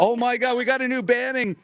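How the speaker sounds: high, excited gushing. Real, not acted. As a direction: burst out with real excitement. Excited about a new banner.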